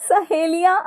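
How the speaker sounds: high crazed cackling round a questioning word; hammed-up mad laughter, word squeezed in high; laughing like mad. Daughter-in-law hearing friends are coming, laughing like mad. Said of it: "पागलों की तरह हंसती हुई बोली".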